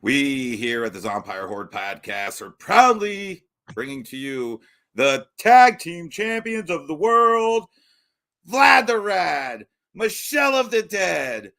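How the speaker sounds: booming wrestling announcer intro; ring announcer. Acted. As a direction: stretch the names out like a ring announcer.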